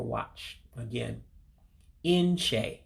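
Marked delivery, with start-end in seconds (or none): 2.0-2.8 inche in two slow syllables, in-che; teacher's slow model of inche. Shown as a demonstration. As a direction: stretch inche out slowly for the class. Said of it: "just two syllables".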